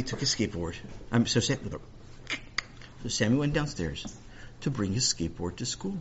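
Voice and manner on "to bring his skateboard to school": usual speaking voice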